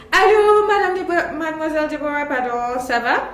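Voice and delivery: high, loud bright hello to a friend; cheery greeting. Pretend. Greeting Deborah on her terrible day, cheery and high. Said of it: "And then I come in and I say".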